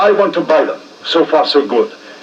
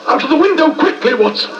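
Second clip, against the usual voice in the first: urgent; 10 semitones higher than usual. Acted. Urgent escape.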